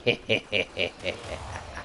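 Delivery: low, evenly spaced chuckle; a low, pulsing laugh. Real, not acted. Gamer laughing as he sends in Rumblers, low chuckle.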